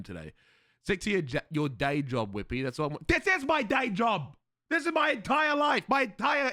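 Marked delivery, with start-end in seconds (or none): 3.1-6.5 high, loud indignant protest; indignant outburst. Real, not acted. Streamer protesting to chat that streaming is his job, indignant and high. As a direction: insist this is your whole life, outraged.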